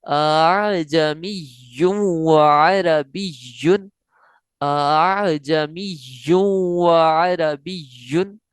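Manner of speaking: अअजमियुन व अरबियुन slow, voice waving on the softened hamza; tasheel recitation. Shown as a demonstration. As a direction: let the voice ripple between hamzas in अअजमियुन. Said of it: "कैसे?". (तसहील के साथ लहराती आवाज़ में)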